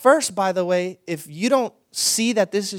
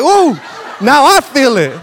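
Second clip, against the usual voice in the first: much higher, loud, laughing ooh now I feel it; joyful cry; 7 semitones higher, 14 dB louder than usual. Retold or imitated. Pastor catching the woman's joy in the lounge, laughing ecstatic cry. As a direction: catch the Spirit with a laughing whoop. Pastor joining her spirit.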